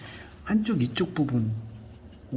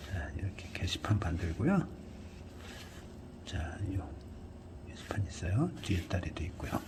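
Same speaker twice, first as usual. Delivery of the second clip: half-whispered aside while painting; murmured to self; 3 semitones lower, 9 dB quieter than usual. Real, not acted. Art tutor murmuring to himself over the canvas, half-whispered. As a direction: mutter under the breath while working the brush.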